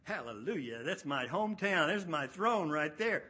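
loud triumphant hometown cheer; excited cheer. Pretend. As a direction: shout it like a king come home. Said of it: "you would think he'd go".

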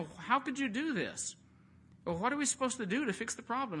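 high, rushed panicked questions; panicked sailors. Retold or imitated. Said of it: "They're saying".

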